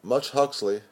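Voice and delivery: plain reading voice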